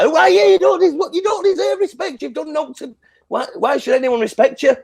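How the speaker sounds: very high, indignant outburst; genuinely mad rant. Retold or imitated. Fan berating Brandy for demanding respect, genuinely mad. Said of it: "I genuinely mad".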